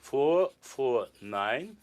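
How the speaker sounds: slow, deliberate repeat of the numbers; slow dictation pace. Shown as a demonstration. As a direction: spell each number out slowly. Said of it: "An old man is not an, uh, fast train".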